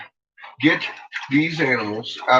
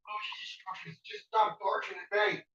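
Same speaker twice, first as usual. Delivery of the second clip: exasperated muttering; 7 semitones higher, 13 dB quieter than usual. Real, not acted.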